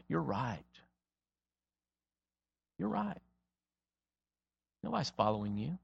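soft, almost breathed; barely above a murmur, gentle; very quiet. Acted. Jesus softly telling the preacher nobody follows him, hushed.

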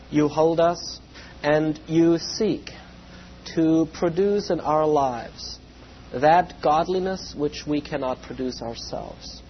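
ordinary talk, nothing marked

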